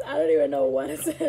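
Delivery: can't get it out for laughing. Real, not acted. Mom laughing at her daughter's angry note, fast and loud.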